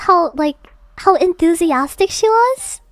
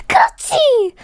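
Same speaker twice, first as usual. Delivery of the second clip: high excited cheer, imitating dad; dad's cheer; 9 semitones higher, much faster than usual. Dad cheering at a good play, pitched high. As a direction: shout 그렇지 up high like a fan. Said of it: "he goes".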